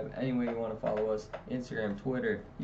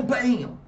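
loud high "Bam!" of a slamming door; sudden slam sound effect; 14 semitones higher, 8 dB louder than usual. Retold or imitated. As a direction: shout the bang of the latching door. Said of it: "latch behind me and go".

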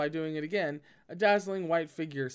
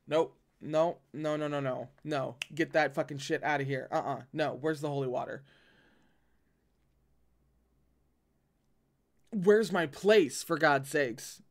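panicked refusal. Real, not acted. Reader refuses in fright.

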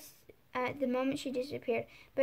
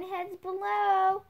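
warning shout; 6 semitones higher, 4 dB louder, slower than usual. Acted. Warning call from the roof.

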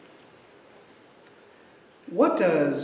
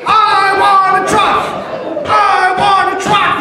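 put-on high, loud chanting toddler shout; sibling yelling; 8 dB louder than usual. Younger sibling joining the demand for a truck, high loud chant. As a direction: join in like a second kid ganging up.

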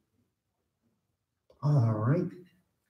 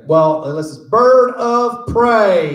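higher, louder, slow Texas bird, proud; 9 semitones higher, 5 dB louder, much slower than usual. Acted. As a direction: lean on it like a smug bird of prey. Benedict voice continued.

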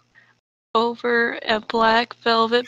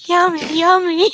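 higher, louder words cracking into laughs; giggling yummy; 6 semitones higher, 5 dB louder than usual. Real, not acted. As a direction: say it while laughing. Giggling, echoing her own word.

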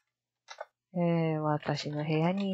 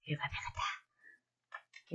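soft whispered relief; 3 semitones lower, 14 dB quieter than usual. Real, not acted. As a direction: breathe it out quietly, relieved. Whispered relief after finding window.

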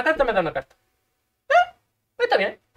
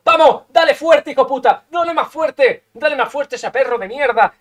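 shouted urging. Real, not acted. Gamer urging his fighter to hit harder, shouting. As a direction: yell them on to hit harder.

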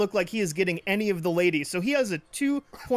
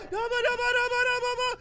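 gleeful gibberish; much faster than usual. Real, not acted. Excited gibberish cheer in game.

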